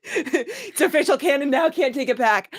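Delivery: real laughter; laughing. Real, not acted. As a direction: declare it through giggles. Joking wrap-up.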